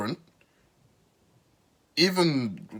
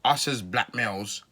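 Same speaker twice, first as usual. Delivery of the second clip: ordinary delivery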